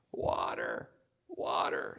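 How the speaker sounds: hoarse dying whisper; parched whisper. Acted. Desert travellers begging for water in a whisper. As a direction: whisper it with no voice left.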